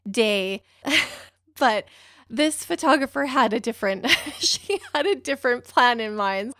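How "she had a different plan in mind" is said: ordinary delivery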